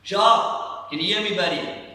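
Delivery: loud, high call across a room; demonstrated shout. Shown as a demonstration. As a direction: shout to someone far at the back. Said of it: "I have to shout".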